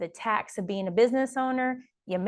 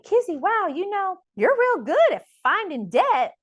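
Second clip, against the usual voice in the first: imitating her bookkeeper's high Southern twang, a bit louder; 11 semitones higher, 5 dB louder than usual. Quoting her sweet Southern bookkeeper teasing her about debt, in a high Southern twang. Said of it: "She kinda had a little Southern twang".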